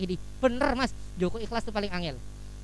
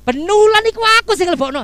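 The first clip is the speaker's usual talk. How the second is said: higher and louder, offended outburst; indignant retort; 7 semitones higher, 9 dB louder than usual. Retold or imitated. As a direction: snap back like a man who feels wronged. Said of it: "Terus ngomong".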